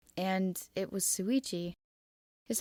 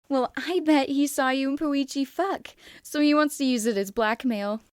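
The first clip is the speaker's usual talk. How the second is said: leer the crude accusation out loud; crude girl; 6 semitones higher, 8 dB louder than usual. Acted. Crude Miu voiced in story.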